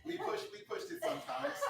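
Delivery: admitting it through high laughter; giggling the confession out; laughing. Real, not acted. Athlete owns up that they overdid it, laughing. Laughing admission about pushing too hard.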